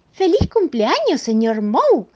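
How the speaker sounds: high, loud, brisker cheer; loud, high birthday greeting; Mrs Moe's birthday cheer. Acted. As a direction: call it out high and merry. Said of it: "dijo la señora Moe".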